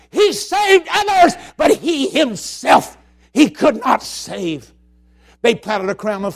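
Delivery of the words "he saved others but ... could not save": high, loud, slow jeering scream; mock angry crowd. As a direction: jeer it out like the angry mob. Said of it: "They screamed at him in anger".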